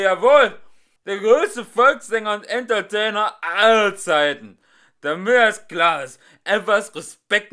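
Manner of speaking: loud high angry ranting voice; drunken furious boast. Acted. Drunk singer boasts angrily at the couple, loud. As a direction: roar his greatness at them.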